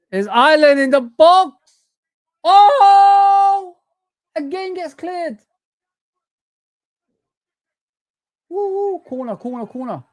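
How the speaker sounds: loud, high shout at the goalmouth; cheering the attack on, real excitement; excited match shout. Real, not acted. Watching a late match chance.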